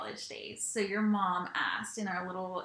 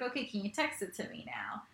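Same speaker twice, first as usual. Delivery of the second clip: whispered, sneaky request; sly whisper; 7 dB quieter than usual. Retold or imitated. Wife mimicking husband asking for her number, whispered and sly. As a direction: hush it like a smooth pickup line.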